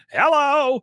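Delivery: very high, loud sing-out hello; big high-pitched mock hello; mimicking client's greeting. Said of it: "This is literally the way the guy talks".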